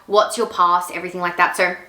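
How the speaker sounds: ordinary talk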